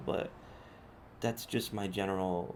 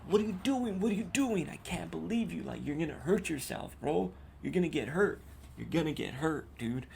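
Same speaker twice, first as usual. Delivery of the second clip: yelling at him; 6 semitones higher than usual. Retold or imitated. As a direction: shout him down, alarmed and furious. Confronting the driver he grabbed.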